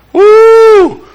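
sharp, high exclaimed whoo; high whoop. Real, not acted. Preacher reacting to his own mockery with a high whoop. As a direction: let out a high whooping cry. Reaction after mocking the leaders.

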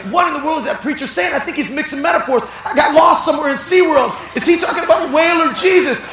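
high and loud, laughing confusion; bewildered half-laughing outcry; confused listener shout. Pretend.

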